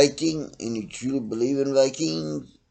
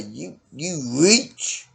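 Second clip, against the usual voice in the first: ordinary delivery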